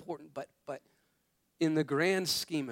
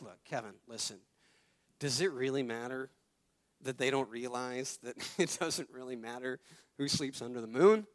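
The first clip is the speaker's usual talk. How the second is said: lower, much softer patient wife; calm wife; 5 semitones lower, 5 dB quieter than usual. Retold or imitated. Wife calmly talking sense into Kevin, low and soft. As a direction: talk him down gently, lower and softer. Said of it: "my wife would be like".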